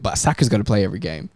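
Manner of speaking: ordinary delivery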